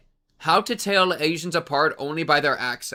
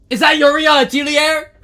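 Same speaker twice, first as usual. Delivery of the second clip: excited recognition; 11 semitones higher, 11 dB louder than usual. Real, not acted.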